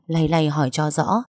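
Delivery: ordinary delivery, nothing marked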